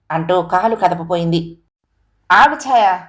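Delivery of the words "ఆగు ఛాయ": loud, higher commanding shout; husband roaring at his wife to stop. A narrator's reading. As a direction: stop her in her tracks. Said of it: "గర్జించాడు".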